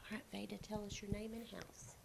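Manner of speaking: hushed aside to the student, barely voiced; whispered prompt. Real, not acted. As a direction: whisper the cue off-mic so only she hears.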